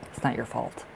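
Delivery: quiet comforting echo of the same words; soft reassurance. Real, not acted. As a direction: tenderly reassure her it's not her fault. Repeated softly to comfort.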